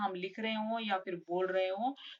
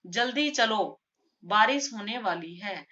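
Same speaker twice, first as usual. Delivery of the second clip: louder जल्दी चलो, clear break, then बारिश होने वाली है; pause after चलो; 5 dB louder than usual. Shown as a demonstration. Teacher demonstrating a comma to students, pause after चलो. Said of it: "थोड़ा रुके ना इधर".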